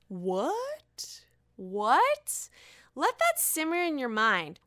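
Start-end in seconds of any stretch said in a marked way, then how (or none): 0.1-2.5 surprised. Real, not acted. Disbelief at gossip.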